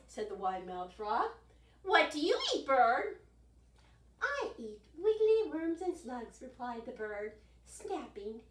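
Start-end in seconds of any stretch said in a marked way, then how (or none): 1.8-3.2 frog voice. Acted. Frog asks the bird. 4.2-6.4 bird voice. Acted. Bird answers the frog.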